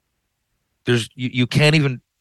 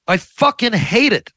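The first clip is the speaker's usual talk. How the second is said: frustrated; 10 semitones higher than usual. Real, not acted. Venting frustration at end.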